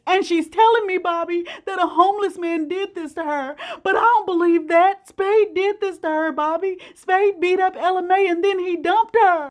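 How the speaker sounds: choking out the accusation, near tears; imitating distraught husband. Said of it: "breaks down crying in a flood of tears".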